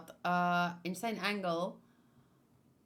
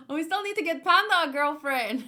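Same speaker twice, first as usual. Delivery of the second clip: excited gasp; 5 semitones higher, 8 dB louder than usual. Real, not acted. Sudden excited idea.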